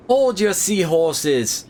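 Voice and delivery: hammed-up bad Aussie accent; terrible Australian accent. Game heroine halting the villains, terrible Australian accent. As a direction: put on a rough attempt at an Australian accent. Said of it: "as I do my terrible Australian accent here".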